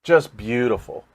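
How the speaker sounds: ordinary talk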